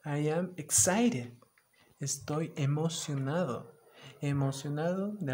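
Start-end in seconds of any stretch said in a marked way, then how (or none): 0.0-1.4 loud, slow excited cheer; hammed-up excitement. Shown as a demonstration. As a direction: show the kids pure excitement. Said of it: "Así, súper emocionado".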